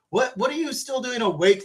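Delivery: ordinary talk, nothing marked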